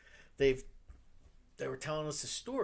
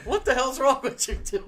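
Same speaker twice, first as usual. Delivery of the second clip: high, loud line broken by laughter; joking, laughing; 12 semitones higher, 10 dB louder than usual. Retold or imitated. Rock star teasing devoted fans, laughing. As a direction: tease them while laughing. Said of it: "said it joking, like, laughing".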